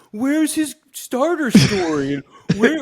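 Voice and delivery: nagging fanboy demanding backstory; whiny fan complaint. Retold or imitated. Mocking fans who demand an origin story, high whine. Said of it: "shut everybody up".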